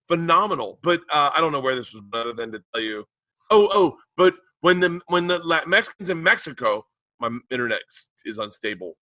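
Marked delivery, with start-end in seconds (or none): none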